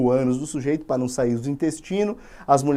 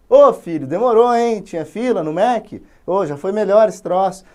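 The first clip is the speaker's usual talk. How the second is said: put-on louder, higher old man greeting; lightly voiced old father; 4 semitones higher, 6 dB louder than usual. Blind father greeting his son, lightly voiced.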